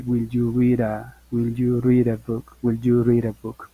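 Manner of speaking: "read" with its D turned into a tapped R joined to "a", said slowly; linked flap R. Shown as a demonstration. Teacher showing the class the fluent American linking. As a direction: glide "read a" into one word, "rira". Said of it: "Esa D se convierte en una especie de R".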